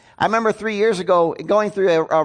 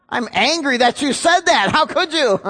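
high-pitched mock indignation, breaking into a laugh; playful fake outrage; mock angry; 7 semitones higher than usual. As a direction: pretend to be outraged at the answer. Mock anger at audience's answer "angry.

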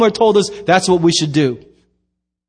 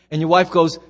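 usual speaking voice

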